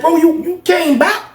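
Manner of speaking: high, slow disbelief; dumbfounded at seeing a friend; astonished question. Retold or imitated. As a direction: stammer it out way up high. Shocked friend is back in prison.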